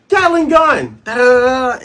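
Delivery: very loud, high battle yell; attack shout. Retold or imitated. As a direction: shout the special move, then imitate rapid fire. Acting out Luffy's attack.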